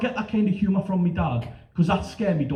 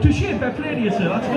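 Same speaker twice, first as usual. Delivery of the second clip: mock-gallant praise; 8 semitones higher, 4 dB louder than usual. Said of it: "I thought".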